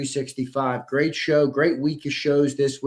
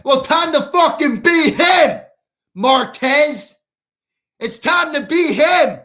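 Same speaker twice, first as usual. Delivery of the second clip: furious shout, much higher and louder; angry shout; 10 semitones higher, 9 dB louder, slower than usual. Real, not acted. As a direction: bellow it at the commenter, fed up. Host rants at a commenter.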